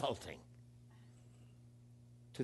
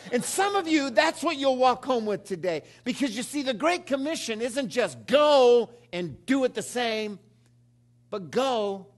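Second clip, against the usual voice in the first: full-force preaching; 6 semitones higher, 5 dB louder than usual. Acted.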